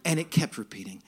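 ordinary talk

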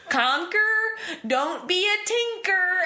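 put-on British phrase in a hard-consonant Southern accent, pitched high and slow; imitating a girl doing a Southern accent, hard consonants; Southern-accent British line. Little girl mimicking an American Southern accent for friends. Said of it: "all of the consonants were just, like, so hard".

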